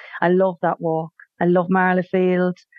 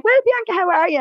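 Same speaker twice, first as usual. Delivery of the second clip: much higher, bright mock hello from a local; cheery local greeting; 10 semitones higher than usual. Townsperson greeting the returning emigrant, cheery mock hello. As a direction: play the chatty neighbour who knows her name. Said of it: "they'll chat away".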